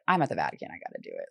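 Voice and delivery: retold thought, dropped soft and half whispered; talking herself into confession at the Vatican, softly; quiet inner voice. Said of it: "And I was like".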